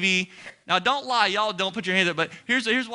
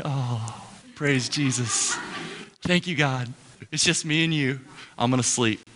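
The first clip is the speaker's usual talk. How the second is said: blissfully lazy; 3 semitones lower than usual. Acted. Pastor acting out a lazy day off, low drowsy contentment. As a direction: sink into the couch with nothing to do.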